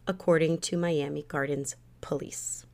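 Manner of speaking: ordinary talk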